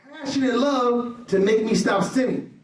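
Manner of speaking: loud, ringing sermon delivery; fiery preacher's voice; preaching at full force. Acted. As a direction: preach it out to the whole room.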